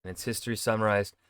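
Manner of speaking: ordinary talk, nothing marked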